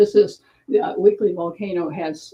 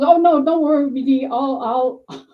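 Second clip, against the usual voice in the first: high, loud fussing; flustered reassurance; 5 semitones higher, 5 dB louder than usual. Retold or imitated. Friend to Dee over the spilled cat food, flustered. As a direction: imitate a flustered friend insisting she'll fix it.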